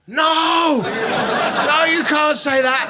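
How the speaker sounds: high, loud mock outrage; hammed-up scandalised cry; women suddenly objecting. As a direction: protest like a crowd of indignant drunk women. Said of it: "the women all suddenly changed, and they were like".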